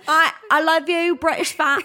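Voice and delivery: high, loud mock-British accent; badly faked British. Reassuring British fans in a badly faked British accent. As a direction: gush in a hopeless British accent. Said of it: "I can't even do it".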